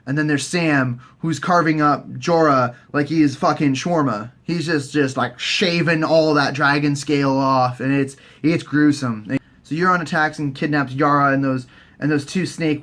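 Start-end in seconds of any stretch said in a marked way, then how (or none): none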